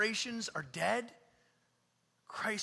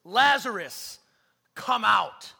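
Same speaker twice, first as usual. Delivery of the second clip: loud, commanding call; big projected summons; 12 dB louder, slower than usual. Acted. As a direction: call out forcefully, each part set apart. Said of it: "called out in a loud voice".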